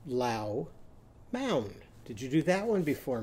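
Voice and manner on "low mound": "Low mound" as two separate syllables, both rhyming with cow; changed vowels. Shown as a demonstration. Teacher modelling lemon with Owl's sound, "Low mound" split with ow vowels. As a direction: say "Low mound" apart, ow in each half. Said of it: "what sound is it going to make?".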